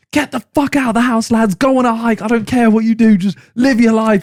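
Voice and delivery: mock-furious impression, high-pitched and ranting; fuming rant. Friend ordering the lads out of the house, fuming. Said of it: "So he's just fuming. He's like".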